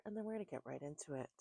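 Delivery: normal talking voice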